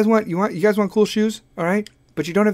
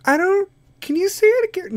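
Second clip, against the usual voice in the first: Kim Kardashian voice; 13 semitones higher than usual. Pretend. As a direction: play a confused Kim, pitched way up. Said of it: "people like when I do the Kardashian voices".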